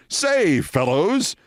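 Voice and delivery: high, chummy "Say, fellows"; mock a clubby three-piece-suit guy; hearty old-boy greeting. [name] greets the group, in a chummy high old-boy voice. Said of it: "who would call a group of men fellows".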